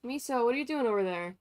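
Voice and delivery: normal talking voice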